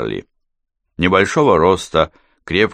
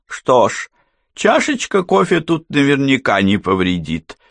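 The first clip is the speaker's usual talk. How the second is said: philosophical woman; 8 semitones higher than usual. A narrator's reading. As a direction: remark it philosophically, resigned to the situation. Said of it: "философски заметила женщина". (философское замечание женским голосом)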